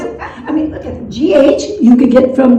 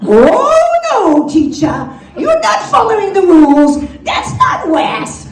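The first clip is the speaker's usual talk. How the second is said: put-on upset little kid; 5 semitones higher than usual. Little Bobby objects that teacher's not following rules, hammed-up wailing. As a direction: whine in dismay like a small pupil.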